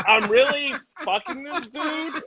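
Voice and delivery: high, slow mock disbelief; a lightly voiced stunned young woman; disbelieving girlfriend. Comic playing the photo girlfriend in disbelief. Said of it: "She's like".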